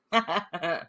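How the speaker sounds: a quick, smug laugh; short laugh. Real, not acted. Laughs after taunt.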